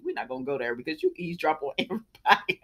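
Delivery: quick low tease breaking into a laugh; teasing laugh. Retold or imitated. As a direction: rib her fast, laughing through it. Teasing Steffi in retold reply.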